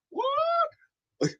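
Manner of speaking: a sudden very high, cracking yelled "What?"; baffled shout. Real, not acted. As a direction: shriek it out in disbelief. Can't hear co-host, shouts.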